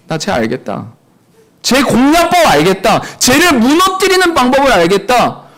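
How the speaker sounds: loud, high, impassioned sermon delivery; full-force preaching. Real, not acted. Preacher warning the congregation about Satan, fiery preaching. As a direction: drive it home to the congregation.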